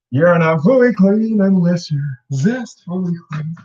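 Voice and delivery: slow, loud commercial voice; sing-song soap ad; ad jingle voice. Pretend.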